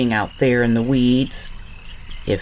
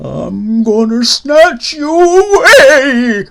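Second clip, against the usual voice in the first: loud, slow, higher spooky threat; menacing haunt moan; 8 semitones higher, 12 dB louder, slower than usual. Acted. Swamp haunt threatening to snatch Liza Lou, loud spooky moan. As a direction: drag out the threat like a ghost. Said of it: "that swamp hot moaned".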